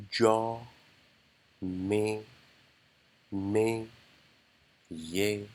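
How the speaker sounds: slow, each syllable spaced apart; one syllable at a time, clearly. Shown as a demonstration. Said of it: "syllable by syllable".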